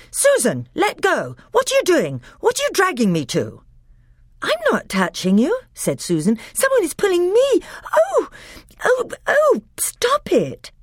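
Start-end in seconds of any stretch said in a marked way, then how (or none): 0.1-3.6 high, louder alarmed cries; panicky, accuse his sister; alarmed protest. Acted. Peter cries to Susan while being dragged, alarmed. 4.4-5.6 put-on higher, indignant girl's voice; insist, a little frightened; protesting girl. Susan protests to Peter, high and indignant. 6.5-10.7 very high, loud frightened oh's; frightened cries. Acted. Susan cries out as the magic drags her, frightened.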